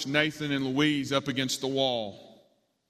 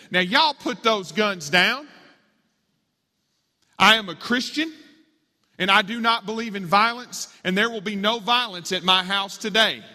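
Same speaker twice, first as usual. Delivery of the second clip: high, loud, emphatic command; feisty raised-voice scolding; stern grandma; 6 semitones higher, 5 dB louder than usual. A narrator's reading. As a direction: order them firmly, brooking no argument. Grandma orders police to disarm.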